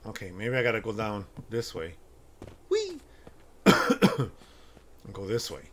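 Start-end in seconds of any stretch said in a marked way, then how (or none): 2.7-3.0 very high playful squeal; playful whee. Real, not acted. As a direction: squeal while dropping. Jumping down.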